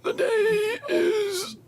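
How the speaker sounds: very high, slow, strained croak; old-person voice. Acted. Streamer at a hundred and six announcing the day, slow strained voice. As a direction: drag each word out high and feeble. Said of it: "we have to talk like".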